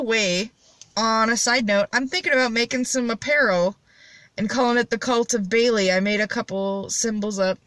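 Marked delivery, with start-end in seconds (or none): none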